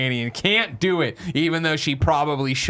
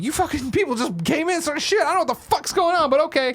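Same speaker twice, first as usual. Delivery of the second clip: put-on much higher, baffled child voice; bewildered kid. Danny reacts to strangers entering, high and bewildered. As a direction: go along confused. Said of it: "And he's like".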